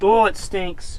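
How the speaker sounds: disgusted groan, out loud; smelling something awful. Real, not acted. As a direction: recoil from the stench. Recoiling from smelly car.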